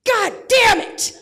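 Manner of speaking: drawn-out furious yell, damn hit hardest; dad's shout. Retold or imitated. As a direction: blow up like an exasperated dad. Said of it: "which goes a little bit like this".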